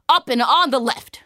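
loud, high guard's reply; forceful, matter-of-fact directions; soldier's answer. Acted. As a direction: call out the spot emphatically. Soldier answers offhand, loudly.

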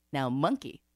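plain reading voice

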